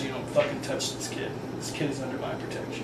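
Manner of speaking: a hushed, deadly warning to back off; menacing whisper. Retold or imitated. Diana hisses a threat at the one touching the kid, whispered.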